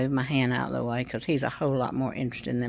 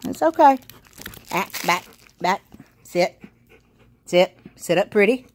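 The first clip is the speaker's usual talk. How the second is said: high, loud commands to the puppy; dog commands; 7 semitones higher, 8 dB louder than usual. Real, not acted. Owner drilling her dog on tricks, high and loud. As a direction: coax the dog into sitting, pitched up.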